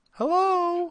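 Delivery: high falsetto hello; put-on lady voice. Host demonstrating a changed voice, mock ladylike. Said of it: "change your voice".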